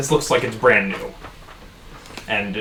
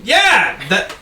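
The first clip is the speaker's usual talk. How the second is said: excited bartender Dennis; 8 semitones higher than usual. Acted. Bartender Dennis introduces himself.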